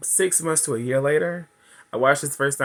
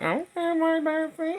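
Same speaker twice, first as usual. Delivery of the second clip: much higher, breathy-sweet put-on voice; mock swooning reply. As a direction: answer him all dreamy and high. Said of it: "I was like".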